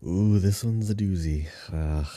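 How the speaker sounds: ordinary talk, nothing marked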